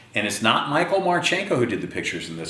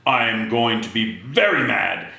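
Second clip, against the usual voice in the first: stern father; 3 semitones higher than usual. Acted. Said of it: "in his sternest father voice".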